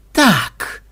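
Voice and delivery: cold, clipped high voice; a cold, menacing question; icy voice. Acted. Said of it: "ледяным голосом осведомился Ксенобайт".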